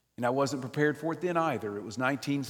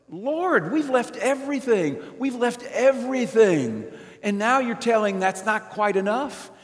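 high, pleading protest to the Lord; Peter's high, aggrieved complaint; 10 semitones higher, 6 dB louder than usual. Retold or imitated. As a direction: plead it like a hurt disciple. Peter complaining to Jesus, voiced.

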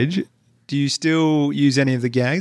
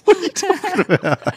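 high laughing protest; laughing disbelief. Real, not acted. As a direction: laugh out the question.

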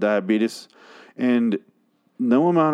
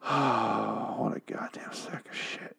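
softer, slow whisper of contempt; disgusted whisper; 8 dB quieter, slower than usual. Real, not acted. Reviewer alone after a sigh, whispering his disgust. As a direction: breathe it out in disgust, barely voiced.

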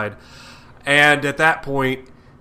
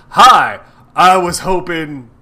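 mock villain greeting; 4 semitones higher, 11 dB louder, slower than usual. Villain greets Spider-Man, retold.

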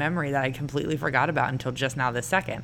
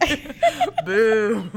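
mock booing; 6 semitones higher, 6 dB louder, slower than usual. Real, not acted. Teasing friend, mock booing.

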